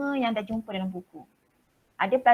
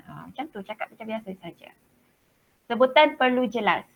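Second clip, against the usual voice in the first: normal talking voice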